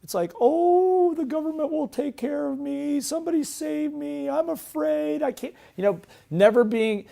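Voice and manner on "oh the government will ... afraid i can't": high, whiny mock-fearful plea; helpless little voice. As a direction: wail like a helpless victim, hammed up. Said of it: "How about this little voice?".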